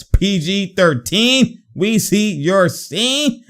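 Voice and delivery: high, slow, stretched hype call; drawn-out catchphrase. Real, not acted. Hyping the player after a basket, high drawn-out catchphrase. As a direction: stretch each word out high, lifting at the end.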